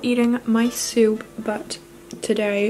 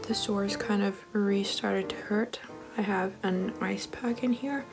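whisper; 6 dB quieter than usual. Real, not acted. Night, hurting, whispering.

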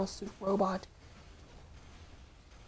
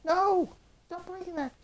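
high-pitched cry of protest; frustrated protest; 8 semitones higher, 5 dB louder than usual. Real, not acted. Player yelling at boss breaking cover, frustrated protest. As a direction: shout no, then plead in frustration. Game enemy destroys his cover.

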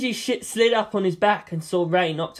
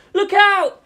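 high, loud warning yell; frantic warning cry; 14 semitones higher, 6 dB louder than usual. Acted. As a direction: shout a sudden warning. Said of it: "someone yelled".

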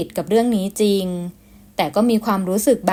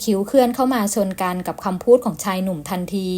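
normal talking voice

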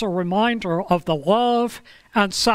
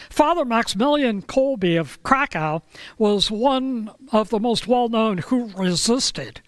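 ordinary delivery

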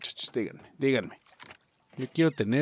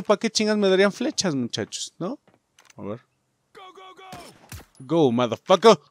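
ordinary talk, nothing marked

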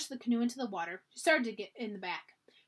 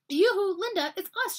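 high, loud call across the water; shouting; 9 semitones higher, 7 dB louder than usual. A narrator's reading. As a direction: wave and call out to a friend. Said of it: "she shouted".

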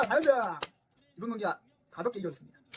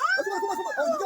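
frustrated shout. Real, not acted. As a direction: cry out at the miss. Near miss in match. (안타까워 지르는 고함)

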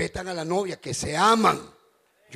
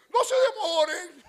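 imitating mother-in-law; 10 semitones higher, 5 dB louder, slower than usual. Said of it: "decía mi suegra".

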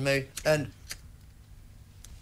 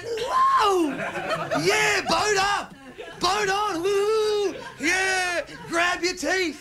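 wild whooping; 13 semitones higher, much slower than usual. Retold or imitated. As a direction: whoop it up like tripping kids. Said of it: "we just went".

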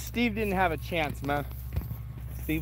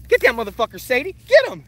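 urging shout; 6 dB louder, faster than usual. Real, not acted. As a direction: egg the dog on, loud. Urging dog on.

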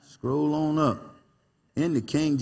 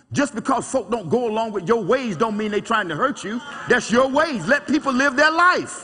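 rising preaching; 8 semitones higher than usual. Acted. Pastor exhorts the congregation to let offenses go, building preaching. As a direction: lift it and drive the point home.

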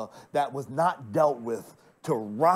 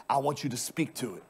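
ordinary talk

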